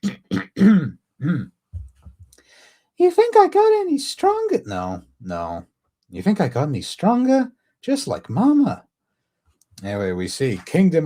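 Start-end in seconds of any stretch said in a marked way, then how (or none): 0.0-4.6 put-on very high, loud little girl falsetto; badly faked squeaky princess; Shuri. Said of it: "One serious attempt at doing Shuri's voice". 6.1-8.9 put-on high little girl voice; put-on child, eager for approval; Shuri. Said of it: "at doing Shuri's voice".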